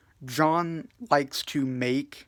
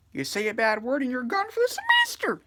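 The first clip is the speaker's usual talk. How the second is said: high-pitched, fast joke impression; Jack Pop; 11 semitones higher, 4 dB louder, faster than usual. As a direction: rattle off the mock warning in a raised, put-on voice. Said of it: "Jack Pop says".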